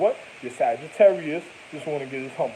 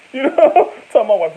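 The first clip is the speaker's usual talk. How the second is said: laughing; 6 semitones higher, 13 dB louder than usual. Real, not acted. Loud laughing interjection.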